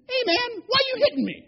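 very high, loud donkey voice; comic high-pitched animal complaint; indignant talking donkey. Acted. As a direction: protest the beating in a high cartoon voice. Beaten donkey suddenly talks back.

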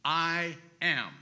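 "I am" as two weighty, separate words; emphatic. Retold or imitated. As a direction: declare "I am" firmly, holding each word. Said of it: "Jesus also said emphatically".

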